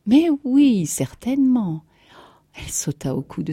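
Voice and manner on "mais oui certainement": high, loud, generous yes; hearty reassurance to a nervous friend; emphatic assent. A narrator's reading. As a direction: agree wholeheartedly, voice leaping up. Friend gladly agrees to lend.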